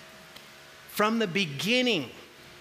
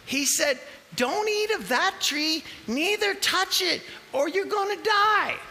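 much higher, earnest woman's voice; Eve's voice; 8 semitones higher than usual. Acted. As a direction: explain the rule like a wide-eyed wife. Eve quoting God's command.

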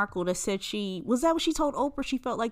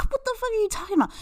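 high, rapid burst of disbelief; incredulous; 14 semitones higher, much faster than usual. Real, not acted. Host reacting to seven years on Tinder, incredulous. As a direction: challenge her, utterly baffled.

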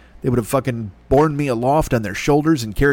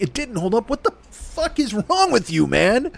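incredulous outrage; 9 semitones higher, slower than usual. Retold or imitated. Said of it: "And I'm like".

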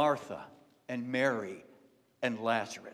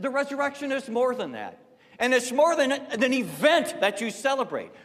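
high, loud, stress on more; preaching Jesus' words; 9 semitones higher, 7 dB louder than usual. Acted. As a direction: drive the word more home. Preaching Jesus' words at full force.

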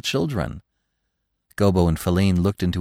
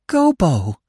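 high, louder cry of sudden recognition; startled cry; 8 semitones higher, 4 dB louder than usual. A narrator's reading. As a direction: gasp out his name in shock. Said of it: "she cried".

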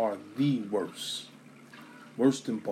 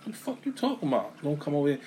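mock incredulous; 3 semitones higher, 4 dB quieter, faster than usual. Player answering the fans at the tailgate, mock incredulous. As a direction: play the disbelieving big guy. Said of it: "He's like".

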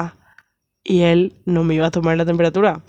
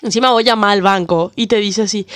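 ordinary talk

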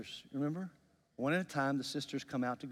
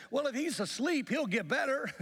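high-pitched, hopeful protest; naive disciples; 10 semitones higher than usual. Retold or imitated. Disciples answering Jesus about their sleeping friend, naive and high-pitched. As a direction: voice the disciples missing the point, pitched up.

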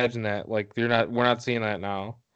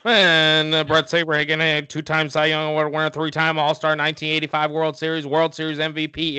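rapid-fire stats in a raised, steady auctioneer pitch; 5 semitones higher than usual. Pretend. Podcast host auctioning off a pitcher's career stats, fast auctioneer patter. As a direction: rattle it off like an auctioneer. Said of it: "talk like an auction-, auctioneer".

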